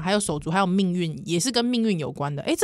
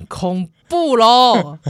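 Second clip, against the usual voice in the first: very slow spooky; 7 dB louder, much slower than usual. Pretend. Drawn-out mock scare.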